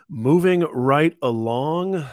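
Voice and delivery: slow, drawn-out repeat of the line. Real, not acted. Host repeating to viewers, slow and drawn out. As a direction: stretch it out like a sing-song echo. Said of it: "Okay, moving right along".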